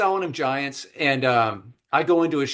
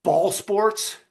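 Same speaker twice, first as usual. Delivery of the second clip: puzzled, higher ball sports question; baffled scorn; 6 semitones higher than usual. Retold or imitated. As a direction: sniff at the whole idea. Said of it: "And Hussein goes".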